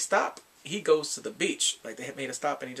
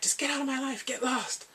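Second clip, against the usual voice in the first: acted angry dismissal; 10 semitones higher than usual. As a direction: send her away in mock anger. Acts out man sending her away.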